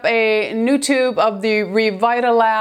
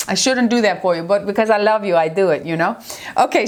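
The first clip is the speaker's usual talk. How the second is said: ordinary talk, nothing marked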